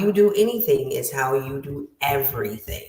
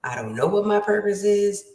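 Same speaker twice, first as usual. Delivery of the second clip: higher-pitched mock lament; lost and exasperated; 6 semitones higher than usual. Voicing listeners who don't know their purpose, lost and exasperated. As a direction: wail it a little, as someone adrift.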